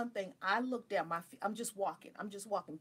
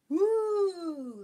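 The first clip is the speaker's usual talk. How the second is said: loud, high, drawn-out "woo"; whoop, gliding down from a high note; excited whoop; 10 semitones higher, 8 dB louder than usual. Real, not acted. Recalling many magical experiences, a long whoop.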